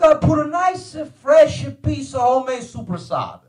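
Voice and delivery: put-on high, relishing Italian-accented explanation; Italian father accent. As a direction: savor each step like an Italian dad. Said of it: "you know how Italian parents are".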